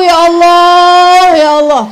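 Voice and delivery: drawn-out, loud dismayed cry; a loud, slow, dramatic lament; anguished ya Allah. Acted. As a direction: wail it slowly in mock despair. Exclaiming disgust at mouldy clothes.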